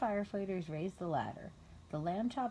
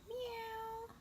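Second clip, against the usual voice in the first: cat meow; 8 dB quieter than usual. A narrator's reading. Cat meows in story.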